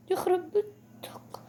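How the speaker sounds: breathy whispered curse, slow; a hushed, slow "يخرب بيتك" under the breath. Real, not acted. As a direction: whisper it in disbelief. Repeats the phrase, first whispered.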